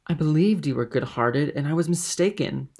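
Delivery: forceful, wounded accusation; reproach. A narrator's reading. Mercedes reproaches her suitor.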